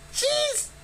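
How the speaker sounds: very high, twitchy bird-woman voice; shrill-pitched harpy mutter. A narrator's reading. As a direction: squeak it out like a jittery harpy. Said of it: "she muttered, looking sideways".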